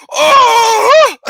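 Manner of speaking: loud high mock grunt of someone hitting the ground; imitated grunt. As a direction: blurt out a shouted, high grunt. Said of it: "this grunt".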